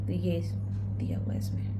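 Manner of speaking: soft whisper to herself; whispered note while reading; whispered aside. Real, not acted. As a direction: murmur it under the breath. Aside while checking the book.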